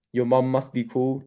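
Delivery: ordinary delivery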